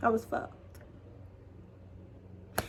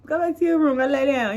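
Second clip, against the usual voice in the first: high urgent pleading; pleading with mom; 8 semitones higher than usual. Retold or imitated. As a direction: talk her out of leaving, fast. Stopping mom going out.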